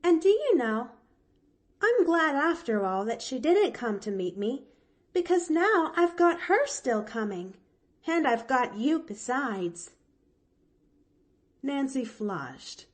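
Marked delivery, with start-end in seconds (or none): 0.0-9.9 high, louder bright chatter; brightly. Acted. Little girl cheerfully telling the maid, bright and high. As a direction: bubble with happy relief. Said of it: "she went on brightly".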